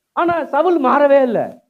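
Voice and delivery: loud, high and drawn out; emphatic preaching. Real, not acted. Preacher contrasting unrepentant Saul with the others, emphatic. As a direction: hammer it home slowly at full voice.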